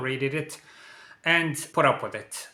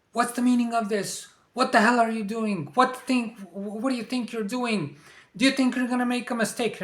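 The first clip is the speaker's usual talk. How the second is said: much higher put-on voice of a boss yelling; 8 semitones higher than usual. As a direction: play the boss blowing up at an employee. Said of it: "starts shouting and yelling".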